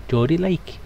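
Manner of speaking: Geordie accent. Shown as a demonstration. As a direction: slip briefly into Newcastle Geordie. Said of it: "the Geordie".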